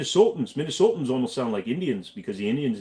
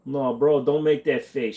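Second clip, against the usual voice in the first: ordinary talk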